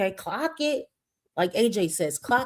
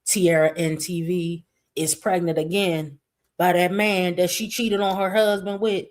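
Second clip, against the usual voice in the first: ordinary delivery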